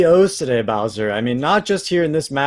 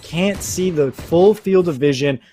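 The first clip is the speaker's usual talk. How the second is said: esports caster; 3 semitones higher, 4 dB louder than usual. Acted. Live play-by-play casting.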